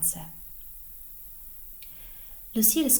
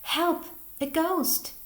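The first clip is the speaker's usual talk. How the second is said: high, raised cry for help; frightened shout; 10 semitones higher, 5 dB louder, slower than usual. A narrator's reading. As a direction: cry out in alarm at a ghost. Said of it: "she shouts".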